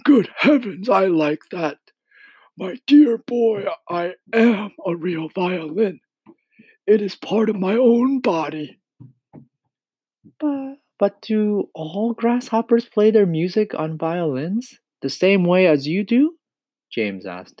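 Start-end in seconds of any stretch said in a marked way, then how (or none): 0.0-8.8 high, emphatic storybook grasshopper; old grasshopper, indignant. Acted. 10.4-16.4 put-on high, questioning child character voice; puzzled young James, pitched up; curious small boy.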